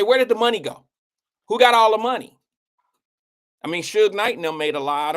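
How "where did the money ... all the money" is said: exclaiming. Real, not acted. Outraged questions about money.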